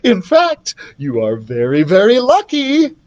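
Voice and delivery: high, delighted queen voice; trembling with delight. A narrator's reading. Queen tells son he is lucky, delighted. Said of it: "The queen trembled with delight".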